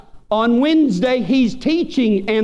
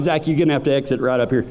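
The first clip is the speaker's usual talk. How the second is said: rushed warning; 8 semitones lower, much faster than usual. Retold or imitated. Warning roommate at the wheel.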